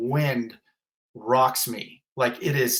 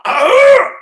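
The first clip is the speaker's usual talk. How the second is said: raw full-throated scream, much higher and much louder; waking scream; 17 dB louder than usual. Retold or imitated. Re-enacting waking from the nightmare to the host, raw scream. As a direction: let out the scream you woke up with. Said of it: "and I'm just going".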